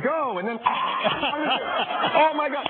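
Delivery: usual speaking voice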